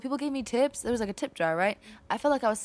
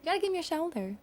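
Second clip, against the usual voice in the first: high-pitched Southern belle accent; 10 semitones higher, 3 dB quieter than usual. Shown as a demonstration. Showing friends how a belle talks, high Southern accent. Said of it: "Talk like this".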